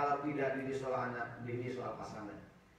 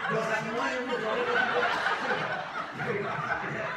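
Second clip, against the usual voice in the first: much louder, higher, laughing; cracking up at the joke; laughing loudly; 11 semitones higher, 7 dB louder than usual. Real, not acted. (tertawa keras sambil bicara)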